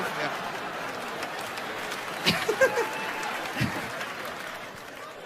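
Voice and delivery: high soft airy laugh; breathy high giggle. Real, not acted. Comic giggling at his own dirty joke.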